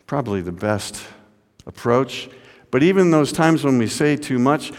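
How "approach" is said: usual speaking voice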